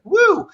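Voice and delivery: high, loud woo; hammed-up cheer; mock triumphant whoop. Acts out character's triumphant return.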